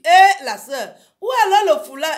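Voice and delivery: imitating a loud, high call; hammed-up calling out; retold challenge. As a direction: holler the question in mock reproach.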